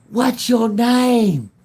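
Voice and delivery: high, drawn-out loud holler; retold long-distance shout; calling across a field. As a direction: yell it long and high to carry. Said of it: "literally across the field".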